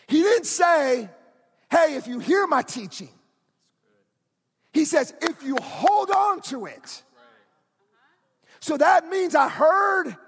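loud, rising sermon climax building to shouts; fiery pulpit preaching; full-force preaching. Acted. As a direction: preach it hard and lift the room.

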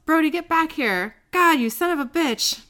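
mock-angry shout after someone leaving; retold yell. Wendy yelling at Brody as he stumbles away, retold yell. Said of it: "She yells".

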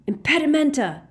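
high, loud cry of an incantation; yelled spell. A narrator's reading. Harry rolling over to jinx his pursuers, yelled. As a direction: yell the curse out, pitched high. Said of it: "he yelled".

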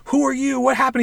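high, frantic questions; panicked questions. Retold or imitated. The clone and original confronting each other, high panicked questions. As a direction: demand answers in a panic. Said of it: "They're like".

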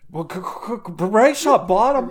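high, loud stuttering panic; panicked stammer. Acted. Warren shouting up from the crypt in panic. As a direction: sputter it out in terror.

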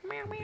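high, soft mock meow; cat meowing. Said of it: "There'll be cat meowing".

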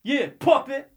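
high-pitched retold heckle; imitated call-out. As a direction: imitate a stranger tossing a jibe from afar. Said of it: "I hear".

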